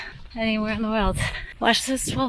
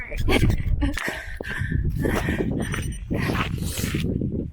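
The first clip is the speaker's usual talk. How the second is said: high sobbing laugh. Real, not acted. Crying-laughing after hard race.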